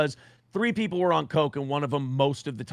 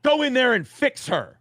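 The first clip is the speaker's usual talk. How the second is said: higher, louder barked order; imitating Nick; 10 semitones higher, 4 dB louder than usual. Nick pointing at him, a higher louder mock order. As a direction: bark the command at him. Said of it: "he goes".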